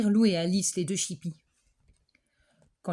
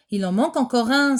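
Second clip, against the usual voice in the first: louder alarmed cry; exclaiming; 4 semitones higher, 7 dB louder than usual. Acted. Teacher counting pupils cries out, louder. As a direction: call out that one is missing again. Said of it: "s'écrie-t-elle".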